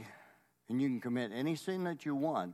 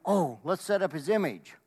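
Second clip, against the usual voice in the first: higher, louder eager suggestion; mock eagerness; 5 semitones higher, 6 dB louder than usual. Deceived people deciding to set up an image, mock eager. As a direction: play the keen crowd having an idea.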